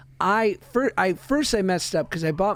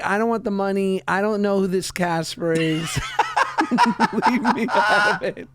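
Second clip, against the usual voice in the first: laughing protest. Retold or imitated. Son refusing ghost money, retold through laughter. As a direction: push the money away, cracking up. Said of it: "he was like".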